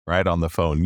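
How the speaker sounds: ordinary delivery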